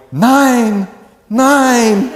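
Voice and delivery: high, loud mock German nine, nine; a hammed-up German woman drooling over strudel. Student imagining an obese Fraulein with strudel, mock German.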